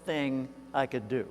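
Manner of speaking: very slow, each word spaced out; pondering question to himself. Retold or imitated. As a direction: weigh it word by word, as if thinking aloud. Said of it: "He asked himself on the way out".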